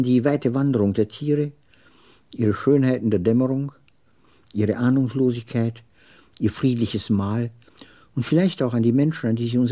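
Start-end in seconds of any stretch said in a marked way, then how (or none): none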